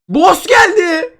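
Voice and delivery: high, slow, loud call; shouted announcement. Real, not acted. Gamer shouting to viewers that the boss came, long loud call.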